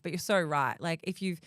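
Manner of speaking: usual speaking voice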